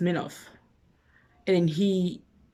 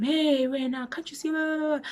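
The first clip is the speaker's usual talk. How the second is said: mock shouting friends; 9 semitones higher than usual. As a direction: imitate friends yelling, at reduced volume. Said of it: "his friends are shouting at him".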